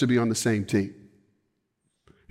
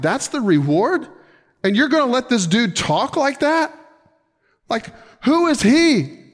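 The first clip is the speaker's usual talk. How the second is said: incredulous David; 6 semitones higher than usual. Acted. David voiced, indignant at the cowards.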